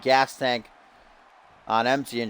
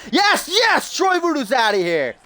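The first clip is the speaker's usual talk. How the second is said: much higher, louder cheering yell; cheering shout; 13 semitones higher, 4 dB louder than usual. Real, not acted. Commentator cheering the cheater being ejected, shouting with joy. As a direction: celebrate the ejection out loud.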